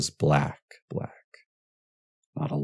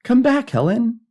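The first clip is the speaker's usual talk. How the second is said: very high, loud call; raised, high-pitched summons; 9 dB louder than usual. A narrator's reading. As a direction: call a runaway back across the room. Calling a child back in dark.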